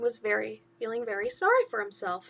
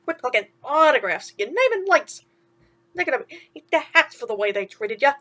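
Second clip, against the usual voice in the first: louder, building hype; excited prediction; 7 dB louder than usual. Acted. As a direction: sell him a dazzling future. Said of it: "Timothy predicted".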